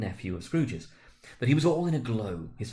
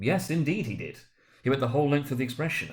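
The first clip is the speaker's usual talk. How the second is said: ordinary delivery, nothing marked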